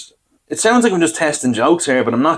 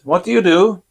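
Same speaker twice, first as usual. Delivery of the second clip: lightly voiced dentist, higher pitch; chatty dentist; 7 semitones higher than usual. Dentist asks the patient his job, retold in a higher voice. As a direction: ask it as the curious dentist.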